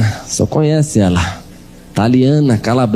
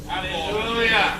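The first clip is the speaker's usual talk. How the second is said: soft hallelujah; 8 semitones higher, 10 dB quieter, much slower than usual. Acted. Murmured aside amid story.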